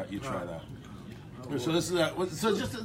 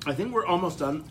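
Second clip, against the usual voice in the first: ordinary talk, nothing marked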